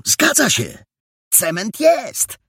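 high, slow, savoured confirmation; triumphant cry. A narrator's reading. Perełka confirms there is cement, triumphant. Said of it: "zawołał triumfalnie Perełka".

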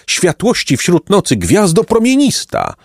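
high, exalted invocation; emotional cry. A narrator's reading. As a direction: call out fervently, pitch soaring. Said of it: "zawołał".

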